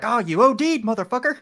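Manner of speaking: high mock yell; retold yell. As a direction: shout it like a crazed addict, half-laughing. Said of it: "he yells".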